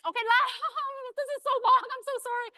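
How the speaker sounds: high-pitched, laughing apology; flustered laughing. Real, not acted. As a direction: laugh through an embarrassed apology. Laughs apologizing for running long.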